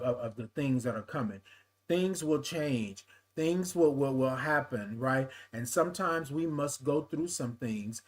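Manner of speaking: tender, earnest comfort; gentle reassurance. Real, not acted. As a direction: settle down and console a struggling friend. Consoling a listener named Erica.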